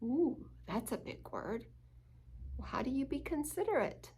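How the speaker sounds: ordinary delivery, nothing marked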